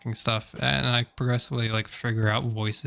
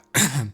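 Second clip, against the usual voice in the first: quick throat clear. Real, not acted. Sore throat, voice prep.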